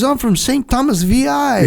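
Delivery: mock proud recruit, stretched out; imitating Butch. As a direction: proudly insist where he's from, a bit hammed up. Butch insisting on his origin.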